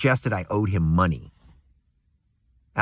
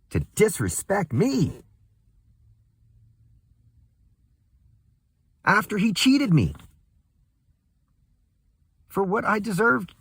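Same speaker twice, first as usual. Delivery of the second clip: rising outrage; 9 semitones higher than usual. Real, not acted. Outraged rant about the ingrate.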